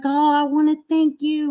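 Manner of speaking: mock her giddy, high gratitude; gushing thanks. Fan thanking the streamer, in an imitated excited high voice. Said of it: "And she's like".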